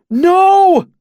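very high, loud shout; panicked high yell; alarmed cry. A narrator's reading. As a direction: cry out across a room to stop someone. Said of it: "Bast cried from across the room".

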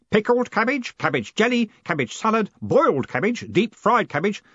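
high-voiced list, each item separate; eager Stanley, unstoppable. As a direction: keep the list coming, keenly.

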